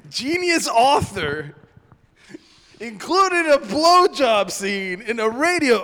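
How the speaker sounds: can't believe what he's hearing; incredulous. Real, not acted. Host reacting to the blowjob scene, loud disbelief. Narrator incredulous about Bradbury script.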